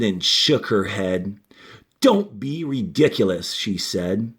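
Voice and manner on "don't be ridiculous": usual speaking voice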